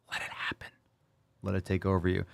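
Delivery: hushed, seductive-style whisper; coaxing whisper. Pretend. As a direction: whisper it like a gentle urging. Coaxing caller's critic to give in.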